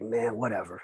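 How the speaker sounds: low, very quiet brush-off; grudging. Retold or imitated. Giving in to his best friend at the audition, grudging. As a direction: mumble it, giving in reluctantly.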